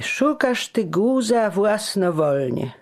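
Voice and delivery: high, slow tearful whine; whining. A narrator's reading. Fat friend whines at reckless Giano. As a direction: plead with a whimper. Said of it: "mówił płaczliwie".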